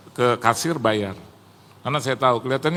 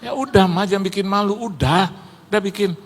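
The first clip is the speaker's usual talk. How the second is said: retold urgent insistence; 7 semitones higher, 3 dB louder than usual. Acted urging of wife in quarrel.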